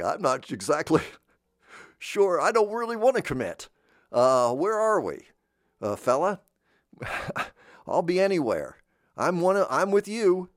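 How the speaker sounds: put-on high, hedging caricature of an American accent; noncommittal American voice. American who'll be anywhere and with anyone, noncommittal caricature. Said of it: "a lot of the American accent".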